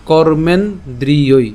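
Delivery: slow, drawn-out kormen-driyoi; kormen-driyoi split at n. Shown as a demonstration. Teacher explains the n, kormen-driyoi slowly split. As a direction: stop on the n of "kormen", then "driyoi". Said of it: "দন্তান্ন পর্যন্ত আমরা উচ্চারণ করলাম".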